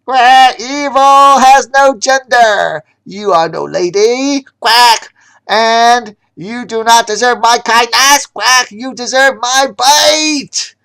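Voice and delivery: high, loud, slow duck war cry; a furious duck pronouncing judgment; mad duck yelling. Acted. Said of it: "the mad duck yelled in an almost human voice".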